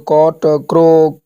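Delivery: कॉट and क्रो drawn out slowly, each with a hard k; slow word demo. Shown as a demonstration. Teacher sounding out words with a hard c, slowly. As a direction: stretch out कॉट, then क्रो. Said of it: "जो ऊपर ए ओ यू के R L".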